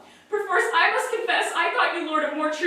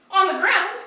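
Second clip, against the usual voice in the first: ordinary delivery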